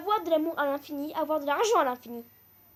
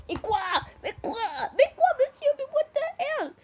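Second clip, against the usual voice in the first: high, loud, incredulous squeal; can't believe the result; outraged disbelief; 9 semitones higher, 4 dB louder than usual. Real, not acted. Vlogger shocked at the poll result, squealing in disbelief.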